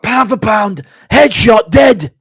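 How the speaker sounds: high, full shout in impression; Leon Edwards screaming. Leon Edwards screaming after his knockout, high shouted impression. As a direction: yell it out in punchy bursts. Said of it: "He changed it up when he was screaming".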